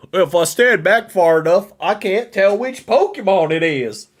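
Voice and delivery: a hammed-up Southern critic squinting from afar; generic Southern accent. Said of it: "That was my generic Southern accent".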